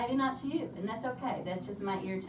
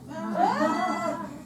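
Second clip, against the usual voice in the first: high, loud laugh bursting out; loud burst of laughter; 12 semitones higher, 8 dB louder than usual. Real, not acted. Embarrassed laugh about teacher's demand.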